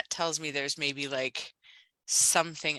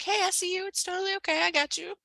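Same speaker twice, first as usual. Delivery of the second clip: high, gentle self-talk; inner reassurance; 14 semitones higher than usual. Retold or imitated. Coach voicing self-compassion, high and gentle. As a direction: soothe yourself kindly. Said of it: "more like".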